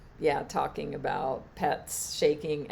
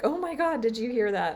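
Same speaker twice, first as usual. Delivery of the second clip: high, louder astonished exclaim; telling a friend on a call, amazed; retold amazement; 8 semitones higher, 5 dB louder, faster than usual. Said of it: "and I was like".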